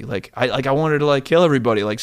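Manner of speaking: normal talking voice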